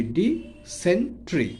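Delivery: Dysentery in three slow pieces, di - sen - tri; syllable by syllable. Shown as a demonstration. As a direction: break dysentery apart with clear pauses, di, sen, tri. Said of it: "ভেঙে ভেঙে উচ্চারণ করব".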